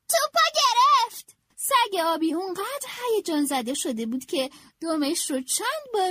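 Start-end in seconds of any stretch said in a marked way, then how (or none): 0.1-1.3 higher, louder, slower cry of joy; excited shout. A narrator's reading. Franklin cheering as Goose catches the ball, a loud excited shout. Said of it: "فرانکلین فریاد زد".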